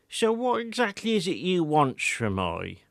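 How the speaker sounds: put-on a proud old villager boasting of his garden; old Wiltshire countryman. A narrator's reading.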